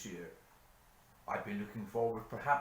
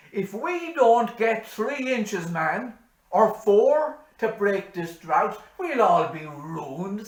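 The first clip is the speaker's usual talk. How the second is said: very loud, high, slow cry of ruin; climactic despair; 11 semitones higher, 14 dB louder, much slower than usual. Acted. Hanrahan at the peak of his gloom, very loud slow cry. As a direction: throw the doom out at full force. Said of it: "said Hanrahan".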